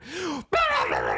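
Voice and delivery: high loud theatrical gasp; mock shocked gasp. Wife reacting to a water request, loud high gasp. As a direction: gasp like she's deeply offended.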